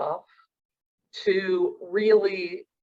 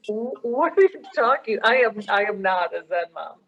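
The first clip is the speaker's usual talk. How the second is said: indignant denial; 3 dB louder than usual. Retold or imitated. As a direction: brush the idea off in disbelief. Mother protests to her son.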